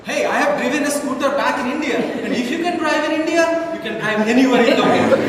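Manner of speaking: mock-indignant boast about Indian driving. Indignant inner rant.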